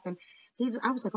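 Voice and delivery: normal talking voice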